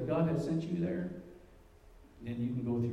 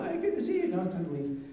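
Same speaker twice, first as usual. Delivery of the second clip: very high, bright hello then a quicker goodbye; hammed-up welcome that turns dismissive; mock cheery greeting; 6 semitones higher, faster than usual. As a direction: greet the odd uncle, then show him the door. Greeting then dismissing the odd uncle.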